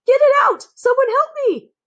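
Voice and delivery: very high, loud desperate shriek; panicked cry for help. A narrator's reading. Cook with a mouse in his knickers screams for help.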